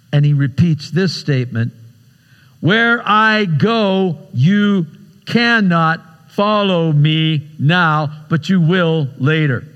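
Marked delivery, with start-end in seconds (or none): 2.6-9.6 word by word, very slowly, starting high; slow, deliberate repetition. Acted. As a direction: spell it out to a stubborn follower. Jesus firmly repeats his statement to Peter.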